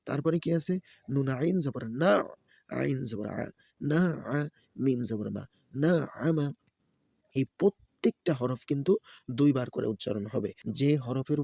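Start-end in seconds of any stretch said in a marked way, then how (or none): 1.1-6.5 sing-song letter-by-letter chant; Quran-primer teacher's recitation; spelling drill recitation. Shown as a demonstration. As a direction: spell each syllable, then lift the joined word. Spells out Arabic syllables for learners.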